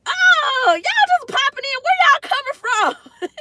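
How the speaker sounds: high, tearful squeal of joy; excited high cry of joy; overjoyed squeal. Real, not acted. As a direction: near tears with delight. Overwhelmed viewers keep joining.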